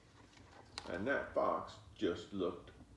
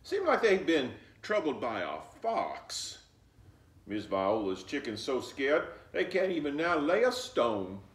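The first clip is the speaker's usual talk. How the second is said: put-on louder, firm old grandmother; 4 semitones higher, 8 dB louder than usual. Grandmother sending Flossie with eggs, firmly. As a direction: send the child off like a no-nonsense grandma.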